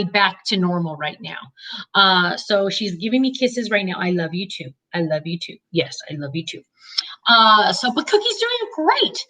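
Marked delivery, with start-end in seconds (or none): 4.0-6.6 soft, lower loving murmur; tender to dog. Real, not acted. Cuddling dog giving kisses. 7.9-9.3 bright happy. Real, not acted. Talking about dog on lap.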